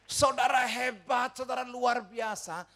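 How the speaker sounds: loud, high, stretched-out words to the crowd; rousing proclamation. Real, not acted. Preacher hypes up the congregation.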